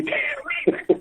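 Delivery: high airy laugh; breathy giggle. Real, not acted. Guest breaks off mid-sentence laughing.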